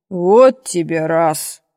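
slow, low-pitched dismay; taken-aback old man. A narrator's reading. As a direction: draw it out in an older man's voice. Old minister reacts to confessed low mark.

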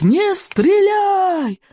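very high, slow desperate yell; yelling across the room. A narrator's reading. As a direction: scream to stop the shot. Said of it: "заорал Стоик".

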